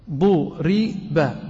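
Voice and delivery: ضرب said duriba, damma first, very slowly drawn out; slow passive duriba. Shown as a demonstration. Teacher answers his own question with a slow duriba. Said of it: "وضعنا عليه ضمة".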